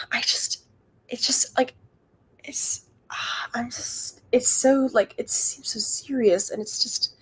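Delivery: drops to a breathy whisper while confessing; barely voiced, trailing off; hushed confession. Real, not acted.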